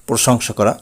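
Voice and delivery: normal talking voice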